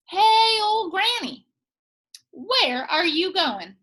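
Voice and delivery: loud high call on hey, slowing on the question; a lightly voiced prickly cactus calling. Cactus calling out to Granny, lightly voiced.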